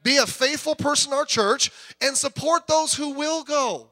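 loud, high, driving preacher; rally the congregation with fire; fiery pulpit exhortation; full-force preaching. Acted.

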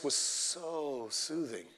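soft, slow, swooning imitation; mock soothing. Listener gushing about a radio preacher, mock soothing. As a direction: draw it out softly, parodying a soothed listener. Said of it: "maybe that's just how he talks".

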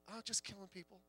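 hushed, careless repeat of the shooter's words; chillingly casual. Retold or imitated. Preacher echoing the shooter's words to the congregation, softly and offhand. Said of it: "like it was a game".

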